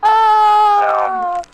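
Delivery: high, loud surprised cry; startled shout. Real, not acted. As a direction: yelp in shock. Startled outburst at the reading.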